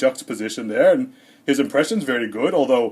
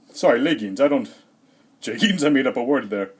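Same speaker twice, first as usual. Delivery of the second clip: ordinary talk, nothing marked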